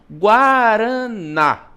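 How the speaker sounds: "guaraná" drawn out syllable by syllable, leaning on the final "ná"; stress on final syllable. Shown as a demonstration. Teacher showing seventh graders the tonic syllable, "guaraná" slowly stressed on "ná". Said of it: "ele é pronunciado com mais força".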